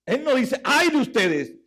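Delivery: high, loud preached scripture; fervent scripture reading. Acted. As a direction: proclaim the verse like a herald. Preacher at full force.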